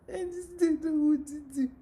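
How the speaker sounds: high, loud, tearful wail; imitating a sobbing student, loud and high; upset crying student. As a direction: act out a student breaking down in tears.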